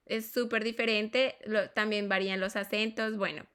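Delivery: normal talking voice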